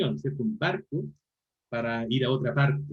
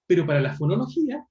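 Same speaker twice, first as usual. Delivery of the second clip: ordinary talk, nothing marked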